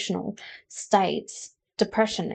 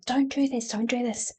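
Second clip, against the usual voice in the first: small, soft, higher-pitched inner voice; little voice; 4 semitones higher, 6 dB quieter than usual. Acted. Intuition warning people about their life, soft little voice. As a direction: plead quietly, as a tiny voice of warning. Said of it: "that little voice telling them".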